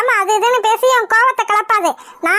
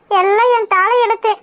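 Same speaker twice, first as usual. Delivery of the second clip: ordinary talk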